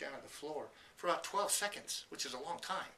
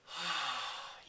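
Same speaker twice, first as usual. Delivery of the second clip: breathy relieved sigh. Shown as a demonstration. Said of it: "Things like this".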